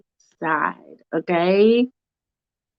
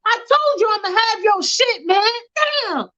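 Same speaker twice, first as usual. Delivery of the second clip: much higher, whiny and wounded; whining hurt guy. Acted. Punched debtor whining at Michael, very high. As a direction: whimper about the punch. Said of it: "And the guy's like".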